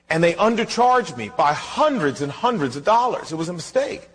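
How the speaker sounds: ordinary delivery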